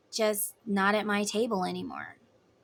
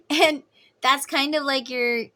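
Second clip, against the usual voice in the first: real high giggle spilling into speech; laughing; 6 semitones higher, 6 dB louder than usual. Real, not acted. Speaker amused by own skit, laughing into the explanation. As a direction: talk on through the laughter.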